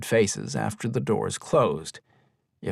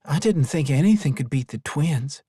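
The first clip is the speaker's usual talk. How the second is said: usual speaking voice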